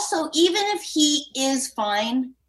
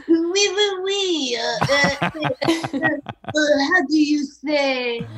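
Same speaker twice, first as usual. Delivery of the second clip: halting mock French accent, fumbling for words; slower than usual. Cohost playing Emma's French date, halting mock French accent. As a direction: stammer like a Frenchman hunting for a word. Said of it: "does he sound like this?".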